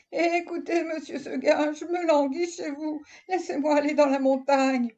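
high, pleading goat voice; pleading little goat. Acted. Goat begs Monsieur Seguin to let her go, high and pleading. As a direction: beg like a lonely young goat. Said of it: "lui dit dans son patois".

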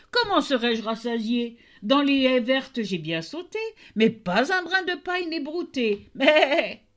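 high, sing-song mocking goat, jumping up on the last line; a spiteful goat lying to the tailor; wicked goat. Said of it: "s'écria". (chèvre méchante et moqueuse, voix aiguë)

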